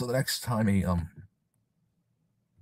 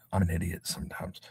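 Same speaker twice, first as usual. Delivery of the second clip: much softer self-deprecating aside; muttering to self; 3 semitones lower, 10 dB quieter than usual. Real, not acted. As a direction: mumble it under your breath. Self-deprecating aside after fumbling.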